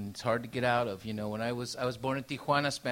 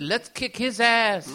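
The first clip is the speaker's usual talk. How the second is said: slow, louder mock threat, laughing through it; hammed-up gang tough; tough bully; 6 semitones higher, 7 dB louder, slower than usual. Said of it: "saw me coming and said".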